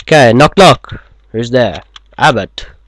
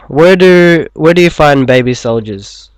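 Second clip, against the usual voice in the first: loud; 4 semitones higher, 8 dB louder than usual. Acted. Loud joke setup, read out.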